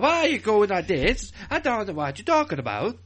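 loud, high American's attempt at an English accent; Brian. Podcaster mimicking a performer, bad American-does-English accent. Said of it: "typical American doing an English accent".

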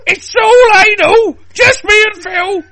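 very high, loud hammed-up squeal; excited shriek. Woman gushing to a friend about Phil, excited shriek. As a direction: shriek it at full pitch.

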